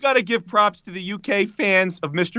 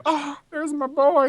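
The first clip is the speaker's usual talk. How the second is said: doting fond voice; 9 semitones higher, 3 dB quieter, much slower than usual. Retold or imitated. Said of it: "I'm like".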